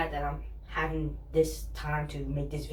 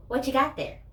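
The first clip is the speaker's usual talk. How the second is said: mock cashier voice, higher and louder; chirpy cashier asking across the counter; imitating a curious store clerk; nosy cashier; 8 semitones higher, 4 dB louder than usual. Cashier grabs my cane, retold.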